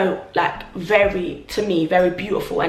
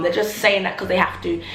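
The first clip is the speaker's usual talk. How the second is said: ordinary talk, nothing marked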